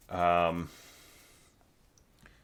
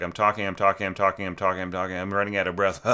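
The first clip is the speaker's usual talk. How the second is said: rapid run-on patter, speeding up; talking a blue streak; faster than usual. Acted. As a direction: rattle it off nonstop until the breath runs out. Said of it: "talking a blue streak".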